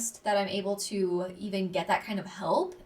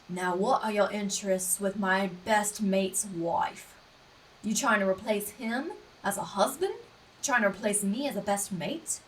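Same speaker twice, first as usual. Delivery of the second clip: Hugh as Wolverine. Retold or imitated. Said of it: "random switch over to Hugh, like, just throw in the Wolverine".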